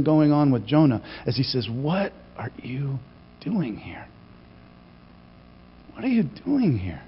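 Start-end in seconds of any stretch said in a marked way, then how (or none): 1.7-4.1 hushed, much softer, slow and gentle; soft questioning voice. Shown as a demonstration. God asking Jonah gently, hushed. 5.9-7.0 soft question. Shown as a demonstration. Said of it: "all kinds of different ways".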